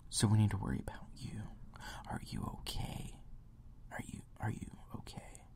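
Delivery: whispered mock concern; soft whispered parody, fading out. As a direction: coo it like a fake-caring counselor. Mock caring clinic worker.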